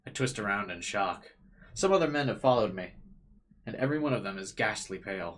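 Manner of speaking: dramatic story narration; dramatic reading. A narrator's reading. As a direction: read it like a tense novel.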